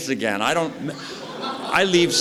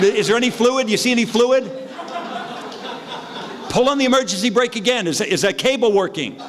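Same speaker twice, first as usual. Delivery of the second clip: frantic checking; 10 semitones higher than usual. Retold or imitated. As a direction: push the cops to check the brakes, voice way up. Frantic checking brakes with cops.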